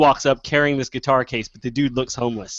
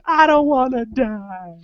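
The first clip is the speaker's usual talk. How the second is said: very slow, high mock wail; high, long-drawn mock cry; retold scream; 11 semitones higher, much slower than usual. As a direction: drag out an imitated scream, falling at the end. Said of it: "he just was screaming".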